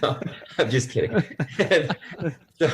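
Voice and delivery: laughing through the words; real laughter while taking back a joke. Real, not acted. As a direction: giggle out the retraction.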